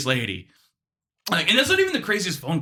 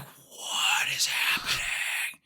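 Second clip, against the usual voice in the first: very slow, drawn-out whisper; baffled whisper; 6 dB quieter, much slower than usual. Retold or imitated. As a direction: whisper it in utter bewilderment. Baffled aside during call, acted.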